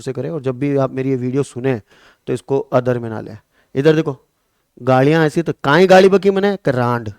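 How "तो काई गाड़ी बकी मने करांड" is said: 1 mock Rajasthani quarrel. Mock village dialect quarrel.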